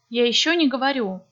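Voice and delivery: normal talking voice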